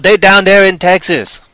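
loud, slow Texas drawl; hammed-up southern drawl; mock Texas accent. Mock Texan talk about Texas.

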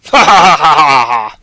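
loud rolling belly laugh; loud, long ha-ha-ha; big hammy laugh. Pretend. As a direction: roar with laughter after your own battle cry. Big laugh after his own roar.

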